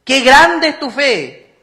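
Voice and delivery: loud, slow, amazed exclamation; exclaiming. A narrator's reading. Jesus marvelling at the woman's faith, loud slow exclamation. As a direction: proclaim it with wonder. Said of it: "con signo de exclamación".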